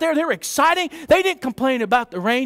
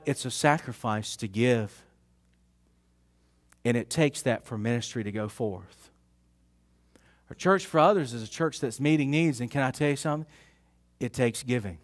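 much lower and softer, intimate sermon; hushed heartfelt preaching; 12 semitones lower, 8 dB quieter than usual. Acted. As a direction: draw them in gently. Soft low preaching.

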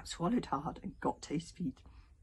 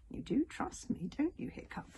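coaxing; 10 semitones higher than usual. Acted. Sly persuasion.

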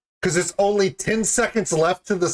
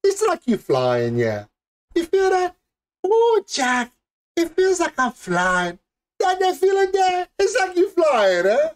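high, hammed-up Cajun accent; playing a Cajun Jack, awed and coaxing; Cajun Jack impression; 11 semitones higher than usual. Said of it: "He goes".

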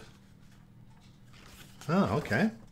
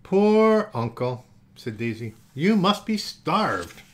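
mock pity; 4 semitones higher, 7 dB louder, much slower than usual.

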